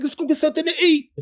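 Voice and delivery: fast, high, put-on buddy voice; high comic impression of a friend; mock high friend voice. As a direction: rattle it off in a squeaky imitation. Friend quoted.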